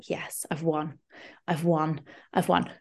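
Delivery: hushed, gloating little victory whisper; whispered triumph. Retold or imitated. Partner finally signs up for the class, whispered triumph. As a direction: whisper it like a secret win. Privately celebrating winning him over.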